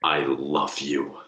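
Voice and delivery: slow, stretched-out mock-earnest delivery; drawn-out declaration. Podcaster hamming up love for listeners, slow and drawn-out. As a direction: draw out each word of the declaration.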